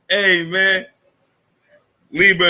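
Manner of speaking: normal talking voice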